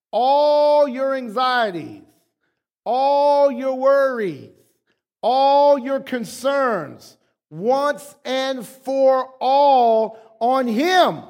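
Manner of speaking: high, slow, sustained declamation; emphatic scripture reading. Real, not acted. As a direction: stretch each phrase out for the congregation.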